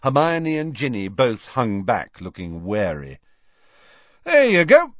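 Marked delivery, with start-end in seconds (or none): 4.2-4.9 loud, high, triumphant presentation; proud. A narrator's reading. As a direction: present the wares with pride. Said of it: "said Fred proudly".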